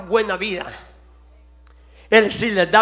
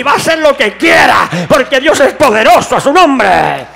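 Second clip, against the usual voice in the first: climbing to shouted, loud preaching; preaching at full force; 3 semitones higher, 10 dB louder than usual. Acted. Preacher rousing the congregation, shouting louder and louder. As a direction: drive it home like a fiery pastor.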